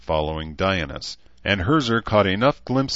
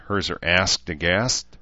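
ordinary delivery

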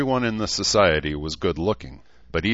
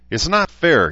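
protesting; 11 semitones higher, 8 dB louder than usual. A narrator's reading. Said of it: "waving a finger at Herzer".

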